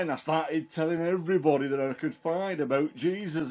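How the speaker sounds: ordinary delivery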